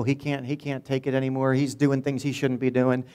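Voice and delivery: normal talking voice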